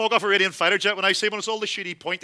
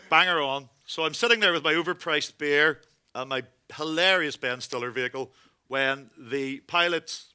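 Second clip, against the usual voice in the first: ordinary delivery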